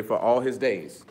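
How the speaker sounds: put-on slow, deliberate recitation for the kids; a slow call-and-response line for children; say it like you mean it. Shown as a demonstration. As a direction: draw each word out so they repeat it. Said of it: "Y'all gonna say it like you mean it?".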